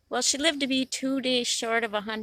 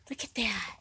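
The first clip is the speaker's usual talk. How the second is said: whisper; 10 dB quieter than usual. Real, not acted. Whispering at a bug.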